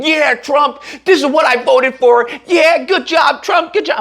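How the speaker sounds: high, slow mock cheer; mock cheering Trump fan. Twitter users cheering Trump in a high mock cheer. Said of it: "they're all saying".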